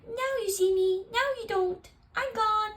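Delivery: high, playful little mouse; a tiny mouse's teasing magic trick. Acted. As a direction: mouse vanishing out the door.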